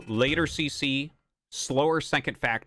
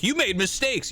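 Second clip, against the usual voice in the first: mocking chatter; 10 semitones higher than usual. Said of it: "He's like".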